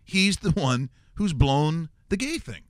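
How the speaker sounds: usual speaking voice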